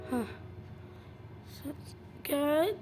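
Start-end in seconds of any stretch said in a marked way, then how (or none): none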